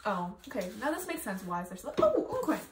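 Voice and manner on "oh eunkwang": high delighted squeal of a name; excited squeal. Real, not acted. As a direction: squeal the name with fangirl joy.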